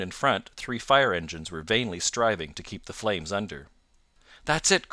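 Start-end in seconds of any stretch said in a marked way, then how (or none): 4.4-4.9 high, loud excited cry; intense excitement. A narrator's reading. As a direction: cry out in sudden recognition. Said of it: "in intense excitement".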